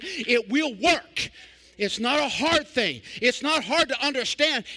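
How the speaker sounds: rising, slow, ringing preacher's cadence; climbing revival fervor; preaching at full force. Acted. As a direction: build the crowd up line by line.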